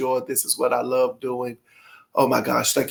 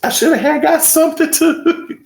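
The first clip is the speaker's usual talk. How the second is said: high, laughing, fumbling with the book; much higher and giggling; giddy excitement; 13 semitones higher, 4 dB louder than usual. Real, not acted. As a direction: squeal of giddy delight. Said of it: "I know I'm silly".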